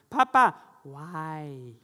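put-on drawn-out, high, loud child's whine; little brother copying. Younger brother copying the older one, slow whiny child voice. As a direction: stretch it out like a toddler copying his brother. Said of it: "He's like".

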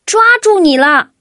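high, loud "抓住你了"; triumphant shout. A narrator's reading. Dodds catches the duck, triumphant yell. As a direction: yell it as you grab. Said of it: "他大喊一声".

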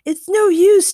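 loud, high cry of despair; shout. A narrator's reading. Chad warns his friends the way is blocked, shouting. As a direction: call out that all is lost. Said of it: "Chad shouted".

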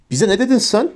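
indignant, high rising challenge; mild acted yell of an offended centaur; shouted. A narrator's reading. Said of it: "diye bağırdı vahşi görünümlü". (öfkeyle, hafifçe bağırarak)

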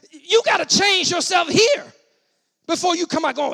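emphatic pulpit demand; forceful exhortation. Real, not acted. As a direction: drive it home to the congregation. Preacher urges congregation.

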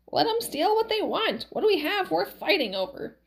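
put-on high, swooping old-woman voice; high-pitched fretting; Valerie, alarmed old wife.

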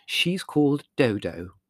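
slow, lightly voiced proud mother. A narrator's reading. Mother proudly naming her new dog to the family. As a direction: announce the name with pride, slowly. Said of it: "regarding her acquisition proudly".

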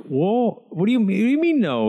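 high, disbelieving what do you mean no; mock outrage, pitch shooting way up; high-pitched retold indignation; incredulous. Husband retelling wife's incredulous reply.

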